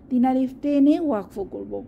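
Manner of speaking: ordinary talk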